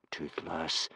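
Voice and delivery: soft, low warning; warning whisper. Acted. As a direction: hush him with a quiet warning. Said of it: "whispered Hiccup warningly".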